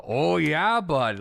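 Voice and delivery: loud, high prairie Canadian "oh yeah bud"; prairie Canadian accent. Retold or imitated. Imitating a prairie Canadian greeting a buddy, in a hammed-up accent. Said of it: "like, the prairies".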